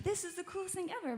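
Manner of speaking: re-enacted thrill, pitched well up; retold excitement. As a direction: gush it high without raising volume. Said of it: "I was really excited about this".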